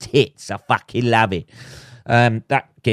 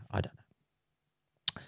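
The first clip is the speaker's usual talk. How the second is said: sheepish whisper; 17 dB quieter, much slower than usual. Real, not acted. Whispered aside after offensive joke.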